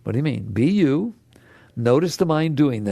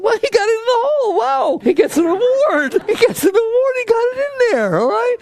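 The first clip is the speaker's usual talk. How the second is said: much higher, squealing delight at a tiny success; overjoyed praise; 3 dB louder than usual. Acted. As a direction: cheer like a proud parent over nothing. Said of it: "I get all excited".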